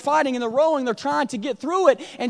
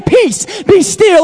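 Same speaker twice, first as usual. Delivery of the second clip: louder, higher, commanding; "Peace, be still" loud and high, full of authority; forceful command; 5 semitones higher, 12 dB louder, much slower than usual. A narrator's reading. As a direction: muzzle the storm with authority.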